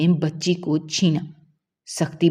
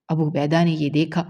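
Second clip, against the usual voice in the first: ordinary delivery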